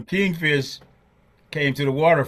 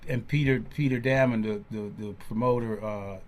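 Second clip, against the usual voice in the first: ordinary talk, nothing marked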